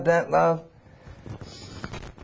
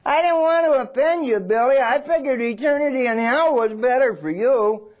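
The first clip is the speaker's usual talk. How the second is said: lame excuse; 8 semitones higher than usual. Retold or imitated. Sheepish excuse to Billy.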